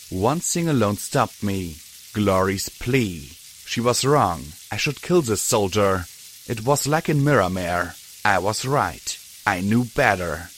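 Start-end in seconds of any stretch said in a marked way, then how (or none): none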